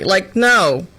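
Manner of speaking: ordinary talk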